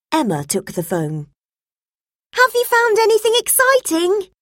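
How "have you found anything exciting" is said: put-on much higher girl's voice, curious; girl asking her father about his finds; eager young girl. A narrator's reading. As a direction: ask like an eager little girl. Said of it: "she asked".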